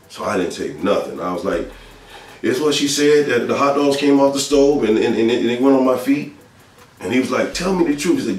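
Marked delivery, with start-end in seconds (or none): 2.4-6.3 put-on higher, louder insistent child's excuse; retold child's excuse. Boy giving dad a cover story, high insistent excuse. 7.5-8.2 high-pitched insistent demand; retold father's demand. Father pressing his son about the burns, high retold demand.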